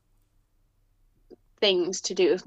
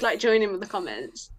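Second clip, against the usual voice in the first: ordinary talk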